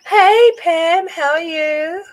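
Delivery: high, loud, delighted hello; excited greeting. Real, not acted. Streamer greeting a viewer who just arrived, excited and loud. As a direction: greet her like a long-lost friend.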